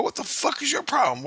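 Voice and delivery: dad snapping at his daughter; exasperated outburst. Retold or imitated. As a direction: throw the question up high in disbelief. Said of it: "I go".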